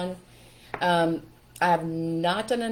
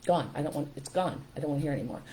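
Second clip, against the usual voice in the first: soft but firm, shutting the thought down; quietly cancel the thought, decisive; low, resolute inner command; firm self-correction; 3 semitones lower, 7 dB quieter than usual. Shown as a demonstration. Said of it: "I would stop for a minute and I would say".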